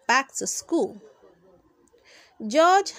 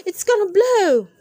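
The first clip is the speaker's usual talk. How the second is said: high, loud alarm as machine breaks; panicked cry; 8 semitones higher, 8 dB louder than usual. Acted. Boy to friends, warning the machine will explode. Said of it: "cried Harold".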